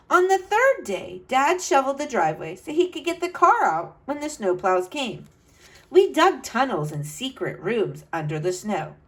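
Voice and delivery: put-on bright, lively read-aloud to kids; storybook narration. A narrator's reading. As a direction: tell it like a storyteller for children. Reads picture book aloud.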